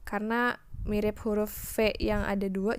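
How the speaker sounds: normal talking voice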